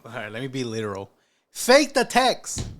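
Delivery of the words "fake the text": loud, high, slowly stretched instruction; exasperated high-pitched yell; emphatic shout. Real, not acted. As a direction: shout it slowly as if to someone who missed the point. Spelling out the advice literally.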